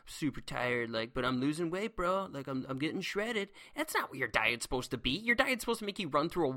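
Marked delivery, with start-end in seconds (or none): 0.0-3.5 low, weary mock dieter; tired dieter. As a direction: imitate a worn-out bro on a diet. Acts out a worn-out dieter.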